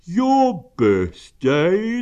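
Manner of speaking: loud, high, drawn-out astonishment; great surprise. A narrator's reading. Pooh learning it is the donkey's birthday, astonished. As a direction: stretch the question out in amazement. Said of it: "said Pooh in great surprise".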